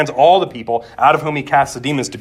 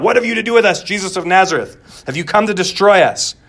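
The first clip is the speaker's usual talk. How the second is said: raised, high cry of a possessed man, easing off; loud, higher-pitched outcry, settling toward the end; 5 semitones higher, 4 dB louder than usual. Acted. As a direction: cry it out as the demon confronting Jesus. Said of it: "and he cries out".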